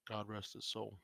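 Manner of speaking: a hushed aside for the lost son; very quietly. Acted. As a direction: drop the voice right down for the dead son. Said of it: "He says that very quietly".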